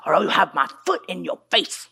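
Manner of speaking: low, stern warning voice of a father; a hint of an angry father. Father warning the son at the restaurant, low and stern. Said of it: "He said".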